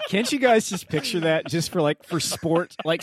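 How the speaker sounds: giggling through the words, high and loud; laughing. Real, not acted. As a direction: laugh while you picture it. Laughing while picturing bears.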